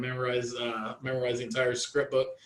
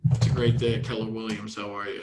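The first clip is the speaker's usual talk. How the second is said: gloomy phone greeting; 4 semitones lower than usual. Shown as a demonstration. Agent greets a caller without smiling, glum. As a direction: lower and fading, no smile at all. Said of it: "you can hear that also".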